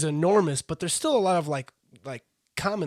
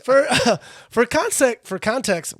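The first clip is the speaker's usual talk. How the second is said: giggly tipsy; 9 semitones higher, 9 dB louder than usual. Real, not acted. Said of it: "wow, context".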